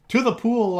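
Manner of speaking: loud, high yell echoing "To the pool". A narrator's reading. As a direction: shout it back eagerly. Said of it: "I yelled".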